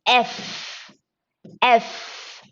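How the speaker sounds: "F" as "ef" with a long f; vowel-sound demo. Shown as a demonstration. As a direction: open "F" on e, then sustain the f. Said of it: "A, again".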